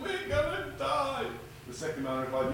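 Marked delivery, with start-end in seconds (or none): 0.0-1.3 panicked wail. Acted. Frantic shipwrecked man pleads.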